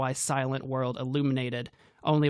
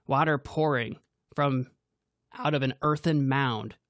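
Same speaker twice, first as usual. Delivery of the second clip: usual speaking voice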